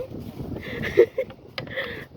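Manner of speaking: squeaky wheezing laugh; real giggle fit; high breathy giggle. Real, not acted. Laughs again.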